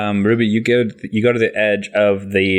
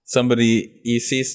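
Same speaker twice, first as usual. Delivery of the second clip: normal talking voice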